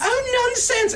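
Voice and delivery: very high, exclaiming mother voice; dismissive mother. Acted. Mother lunging to hug Orpheus, dismissive. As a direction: wave away modesty with affection.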